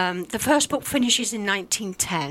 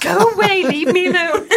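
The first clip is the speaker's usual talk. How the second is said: laughing shoo-off; 7 semitones higher, 4 dB louder than usual. Acted. Shooing off the voice, laughing.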